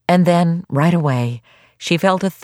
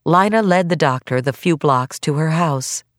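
ordinary delivery

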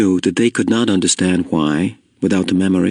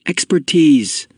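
slow, higher-pitched correcting word; automatic correction; 6 semitones higher, slower than usual. A narrator's reading. Jonas fixing his friend's word, automatically correcting. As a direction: slip in the correction by reflex. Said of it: "Jonas corrected him automatically".

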